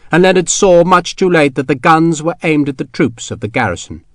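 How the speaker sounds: usual speaking voice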